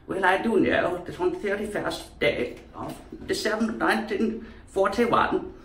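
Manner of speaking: high Queen's English address; old English accent. Acted. Speaker announces a date like royalty, old English accent. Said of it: "Queen's current accent probably is the old English".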